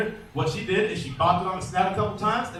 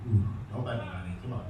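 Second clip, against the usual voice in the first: coaxing murmur; 9 semitones lower, 7 dB quieter than usual. Real, not acted. Coaxing the alligator's mouth open.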